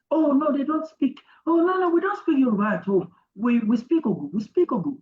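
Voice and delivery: high, quick mock denial; imitating insistent villagers. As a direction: play the villagers hastily denying they speak Yoruba. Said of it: "everybody kept on saying that".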